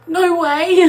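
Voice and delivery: high, loud squeal of no way; thrilled she can't believe it; delighted disbelief. Real, not acted. Mum-to-be reacting to viewers offering to come, in delighted disbelief.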